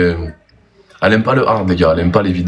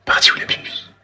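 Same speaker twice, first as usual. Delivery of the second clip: whispering; 4 dB quieter than usual. Real, not acted. Cherche la pupus en chuchotant.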